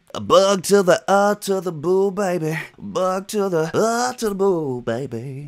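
high, drawn-out playful country twang; slow, high Southern sing-song; country accent. Acted. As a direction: belt it out like a hammed-up country hook. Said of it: "turning my country accent back on".

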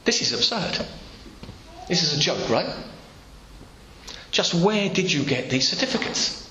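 as if it must be a joke; astonished gatekeeper. Pretend. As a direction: gatekeeper baffled by the certificates. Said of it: "a man just looks at you and says".

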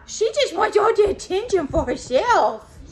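high, loud whiny complaint; high whiny dog voice. Pretend. Dog voiced by owner, high and loud, complaining about Reva. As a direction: whine it like a jealous pet.

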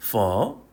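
퍼 low and dipping, then rising; learner's tonal 퍼. Shown as a demonstration. Teacher confirming the trained reading, tonal 퍼. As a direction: let 퍼 sink and swing upward. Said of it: "이렇게 읽는 거예요".

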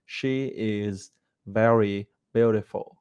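slow, careful "She is very beautiful" with a clear sh; slow teacher demo of the sentence; careful model English. Shown as a demonstration. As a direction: model English sentence, each sound distinct. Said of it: "Hiếm có bạn nào thầy thấy phát âm là".

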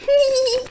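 little goat giggle; high giggle. Acted. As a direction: giggle at the tricked troll. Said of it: "he giggled".

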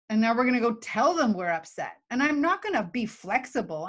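ordinary talk

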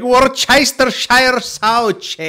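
high, loud, drawn-out Italian-style imitation; mock Italian reading. Mimicking the man reading the label, mock Italian accent. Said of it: "and he's still like".

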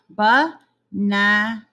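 Banana spoken very slowly, each syllable ba-na-na drawn apart; syllables stretched slowly. Shown as a demonstration. Teacher to young pupils, slowly stretching Banana into syllables. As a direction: pull Banana apart into three slow beats. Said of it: "Let's put 'em back".

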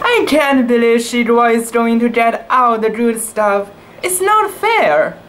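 high, whiny jealous kitten voice; sulky little sister pouting; jealous little cat. A narrator's reading. Jealous kitten sulking over her sister's party. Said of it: "Satwa signed".

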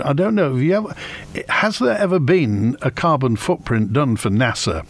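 normal talking voice

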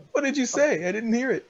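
high-pitched mock bewilderment; hammed-up high questioning; imitated puzzled asking. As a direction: ask it in an exaggerated high voice. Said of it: "And I'm like".

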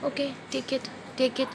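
quietly giving in, okay take it; soft giving in. Real, not acted. Gently giving baby the phone.

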